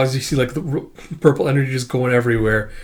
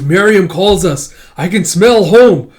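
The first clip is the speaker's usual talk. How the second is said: slow, higher and louder; hungry eager voice; 6 semitones higher, 8 dB louder, slower than usual. Acted. Calling out that she can smell home, slow and hungry. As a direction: savor each word like a starving creature. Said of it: "And she goes".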